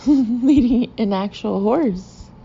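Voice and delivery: real laugh breaking into slow, loud words. Real, not acted. Teller amused by groomsmen bringing a horse, laughing. Narrator cracks up at own story.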